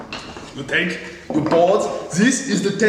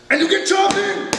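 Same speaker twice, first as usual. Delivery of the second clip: rally yourself to chop; hammed-up battle cry; 10 semitones higher than usual. Woodworker charging at the chisel work, hammed-up yell.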